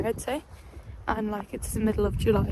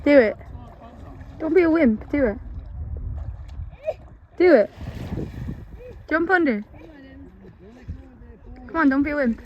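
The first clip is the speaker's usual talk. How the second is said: teasing dare; 2 semitones higher than usual. Real, not acted. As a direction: egg her on, cheering. Daring friend to swim.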